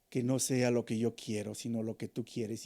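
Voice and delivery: hushed submissive prayer; humble prayer. Retold or imitated. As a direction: pray it gently, surrendering your will. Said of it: "Sabes cómo debemos orar?".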